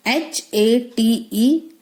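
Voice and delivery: ordinary delivery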